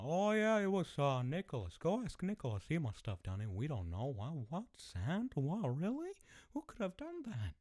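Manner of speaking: very high-pitched feigned surprise; mock innocent kids. Kids telling dad Nicholas did it, exaggerated innocence. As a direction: play little fibbers acting clueless.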